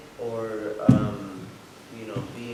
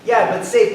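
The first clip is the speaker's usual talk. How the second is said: safe hit hard, much louder and higher; emphatic safe; 9 semitones higher, 15 dB louder than usual. Real, not acted. To the group, insisting the space must feel safe, emphatic. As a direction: lean right into safe to insist on it.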